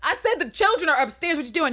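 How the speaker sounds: high, incredulous shriek; wife shocked he ignores the kids; sharp high shocked outburst; outraged disbelief. Retold or imitated. Angela outraged.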